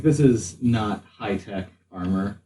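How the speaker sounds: ordinary talk, nothing marked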